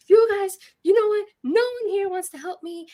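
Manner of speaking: high-pitched, loud re-enacted tirade; crazed rant. Patient telling clinic staff nobody will help, crazed rant. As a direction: launch into it like a patient at breaking point. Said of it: "and I am on a rant. I've gone full crazy".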